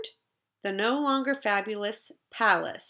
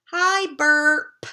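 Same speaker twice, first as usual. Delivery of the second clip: louder, higher mocking hello; teasing; 6 semitones higher, 8 dB louder than usual. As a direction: tease him by name. Teasing greeting.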